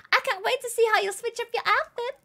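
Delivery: very high, gasping character voice; high put-on delighted voice; excited game character. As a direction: gasp and gush it in character.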